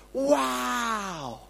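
long drawn-out, gliding wow; awed wow. Acted. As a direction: stretch it out in wonder. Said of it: "when we hear harmonies and we think".

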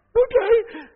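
high, loud little reply; mock nervous squeak. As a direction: squeak it out, comically scared. Frightened person answers the angel.

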